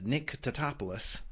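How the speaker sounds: put-on a light American accent on the name. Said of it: "I did the American accent there".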